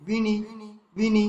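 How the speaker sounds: "বিনি" with two quick short i sounds; short vowels. Shown as a demonstration. Teacher showing both are short, "বিনি" cut short. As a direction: say "বিনি" briefly, stopping at once. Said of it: "এই দুটো হ্রস্ব আছে".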